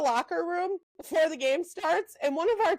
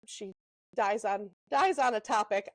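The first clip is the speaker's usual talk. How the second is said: ordinary talk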